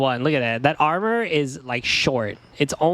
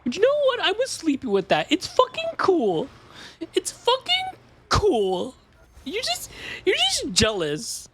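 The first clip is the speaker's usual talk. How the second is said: mock-defensive squeal. Streamer defends something cool against the jealous, in mock-defensive squeal. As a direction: squeak it out in exaggerated high-pitched protest.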